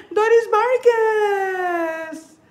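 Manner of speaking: high, slow, drawn-out call of a name; sing-song greeting. Real, not acted. Host greeting a fan by name, sing-song and drawn out. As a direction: stretch the name out like a happy song.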